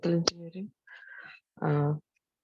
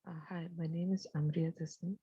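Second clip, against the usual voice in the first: very soft, faint mic voice; barely audible; 9 dB quieter than usual. Real, not acted. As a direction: speak too softly to hear. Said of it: "Your voice is very low".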